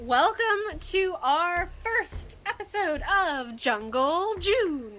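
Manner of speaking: slow, high, drawn-out host voice; hammed-up host voice. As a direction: stretch the welcome out like a showman. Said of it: "I don't know why I did that".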